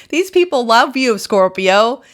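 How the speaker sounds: high and loud joyful insisting; excited. Real, not acted. Reader telling Scorpio viewers they are loved, high and loud. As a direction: thrill at the good news.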